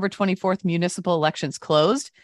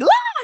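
short, high mock scream; retold scream; 5 dB louder than usual. Telling co-hosts about the squirrel leaping out, retold scream. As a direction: yelp out a sudden high scream, retelling it. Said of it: "I screamed".

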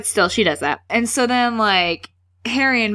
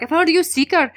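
higher-pitched mock Scottish accent; McGonagall; 8 semitones higher than usual. McGonagall tells Wood she has a seeker, mock Scottish accent. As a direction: announce it in put-on Scottish. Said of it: "she has a Scottish accent".

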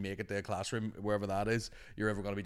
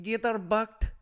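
high, loud pupils' echo; mimicked children's chorus; children echoing teacher; 12 semitones higher, 6 dB louder, slower than usual. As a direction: shout it back like a class of kids. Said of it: "And they're like".

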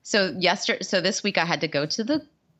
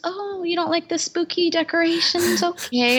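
lightly mimicked upbeat doctor, pitched up; mock-sweet doctor, higher; chirpy doctor, mock high voice; 12 semitones higher than usual. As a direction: play the cheerful gyno, bright and high. Doctor voiced in retold story.

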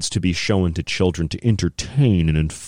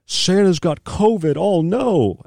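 much higher, slow, hammed-up fright; mock panic; 11 semitones higher, slower than usual. Mocking worried people, much higher in hammed-up fright. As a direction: squeal it like fake-worried gossip.

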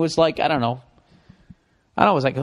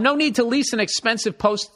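ordinary talk

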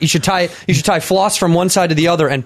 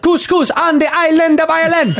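high, Jamaican-sounding Irish welcome; singsong fake Irish gone Jamaican; botched Irish accent; 10 semitones higher than usual. Shown as a demonstration. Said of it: "that sounds so Jamaican".

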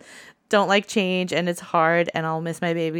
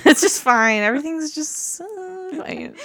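mock-cheery "fine" that sinks; sarcastic, collapsing at the end; 9 semitones higher than usual. Shown as a demonstration. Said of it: "Yeah, it's fine".

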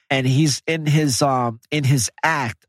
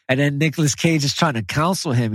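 ordinary talk

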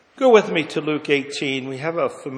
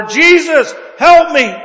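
loud, high desperate cry; crying out louder; 10 semitones higher, 12 dB louder than usual. Acted. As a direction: shout for help with everything you have. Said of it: "we cry out the louder".

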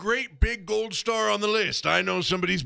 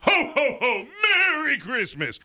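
loud, high jolly Santa laugh; belly-laugh the Santa sign-off; 6 semitones higher, 3 dB louder than usual. Acted. Santa signs off call.

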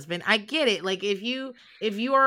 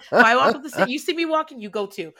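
laughing; 3 semitones higher, 8 dB louder than usual. Real, not acted. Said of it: "laughing while scolding".

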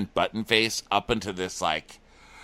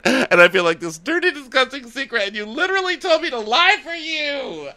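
climbing to a high wail; mock distraught. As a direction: imitate her accusation building into a squeal. Acting out her upset accusation.

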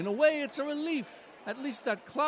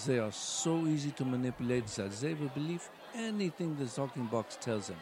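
put-on much lower, German-accented villain voice; Klaus Schwab impression; 11 semitones lower than usual. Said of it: "Klaus Schwab".

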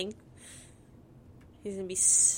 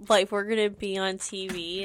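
normal talking voice

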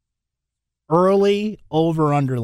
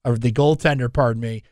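usual speaking voice

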